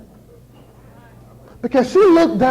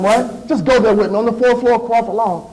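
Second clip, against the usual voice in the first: ordinary delivery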